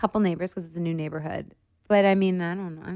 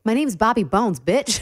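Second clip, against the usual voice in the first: louder brash mock answer; cocky mock reply; 4 semitones higher, 5 dB louder, slower than usual. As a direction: sass it like a swaggering star. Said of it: "I did not say that in any way".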